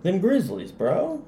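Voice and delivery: high hyped bro voice; excited. Real, not acted. Hyped backing his team.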